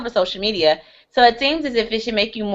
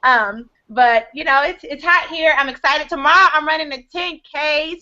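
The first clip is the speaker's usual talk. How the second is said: ordinary talk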